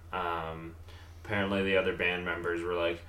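ordinary talk, nothing marked

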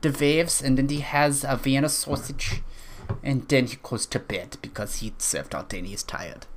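put-on German accent, a little halting; vaguely German accent. Podcaster playing a German audio surfer, vaguely German accent. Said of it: "vaguely German".

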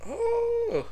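very high squeaky oh; shocked oh. Real, not acted. Host stunned by the post, high-pitched oh. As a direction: react in stunned disbelief.

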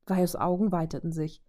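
ordinary delivery, nothing marked

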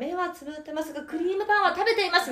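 high sing-song play-by-play, laughing; breathless rising goal commentary. Pretend. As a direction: call each wobble like a match finish. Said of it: "ずっと実況して".

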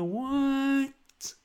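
very high, squeaky disbelief; hammed-up indignant what; mock outrage. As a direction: squeak out offended shock. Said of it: "I'm like".

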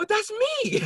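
high-pitched retold astonishment; amazed recognition. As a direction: squeal it as you recognize yourself. Retold shock of recognizing himself.

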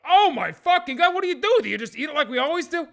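high, loud amazed outburst; astonished onlooker. Acted. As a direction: blurt it out in mock astonishment. Astonished crowd reacts to toast.

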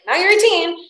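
parents' voice lightly imitated, high and loud; a hint of stern parents; mock parents. Parents changing the rules, lightly mock stern.

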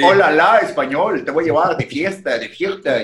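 lightly put-on Spain Spanish accent, hammed-up; a clumsy fake Spain Spanish accent from a friend; bad Spain Spanish accent. Friend mocking a Spaniard, with a bad Spain Spanish accent. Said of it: "Yo sé que así no hablan español".

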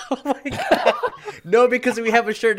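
laughing through the words; giggling while talking. Real, not acted. Laughing at shirt joke.

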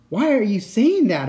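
high, loud scared plea; frightened cry. Acted. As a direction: cry it out in fear. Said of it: "I cried".

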